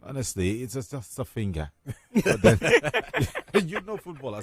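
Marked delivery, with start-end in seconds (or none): 1.9-2.6 bursting into laughter mid-sentence; real laughter at the joke; laughing. Real, not acted. Laughing at Neymar's dramatics. 2.6-3.6 loud high laugh; high burst of laughter. Real, not acted. Laughter at Neymar joke.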